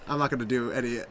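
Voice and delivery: ordinary talk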